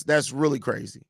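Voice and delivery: normal talking voice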